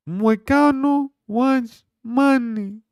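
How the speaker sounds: high, loud and slow put-on voice; mock fighter voice. Streamer voicing Moicano in a loud slow put-on voice. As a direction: stretch it out like a fighter hyping himself.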